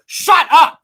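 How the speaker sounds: loud, high mock shout; shouted shut up. Jack and Rose shouting down the steward, loud mock yell. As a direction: yell it right back at him. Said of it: "Jack and Rose go".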